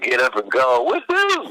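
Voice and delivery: big rising whoop of joy; excited whoop. Retold or imitated. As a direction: cheer him on to floor it. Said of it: "It's like".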